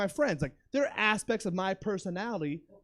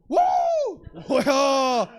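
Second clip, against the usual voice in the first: high, loud electronic whoop; imitated defibrillator noise; 4 semitones higher, 6 dB louder than usual. Man hearing defibrillator when touched, loud imitated whoop.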